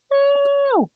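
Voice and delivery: high playful boos; mock booing. Kids booing Thomas on screen, high and playful. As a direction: boo the villain like a pantomime crowd.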